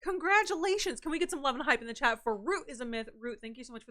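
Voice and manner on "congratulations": high, loud, slow celebration; excited. Real, not acted. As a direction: cheer them on. Cheering a new badge.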